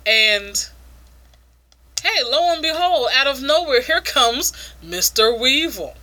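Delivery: ordinary talk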